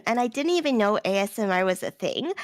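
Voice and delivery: normal talking voice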